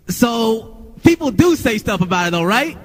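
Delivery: high, drawn-out baiting question; pushy interviewer. Retold or imitated. Imitates pushy interviewer baiting her.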